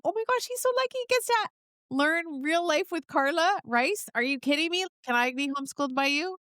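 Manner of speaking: put-on an awestruck kid raving about his teacher; gushing little boy. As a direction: play the starry-eyed little son. Voicing her little son's gushing thoughts.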